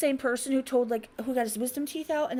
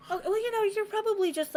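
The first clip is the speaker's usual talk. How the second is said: high dismissive brush-off; 11 semitones higher than usual. Retold or imitated. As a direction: brush him off, much higher. Teller quoting herself dismissing him.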